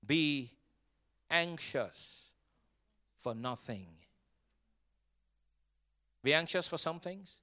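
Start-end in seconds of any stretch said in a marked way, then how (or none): 0.0-4.0 each word alone, slowly, softer; slow and spaced. Shown as a demonstration. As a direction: drop every word one by one, quietly. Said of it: "Jesus said".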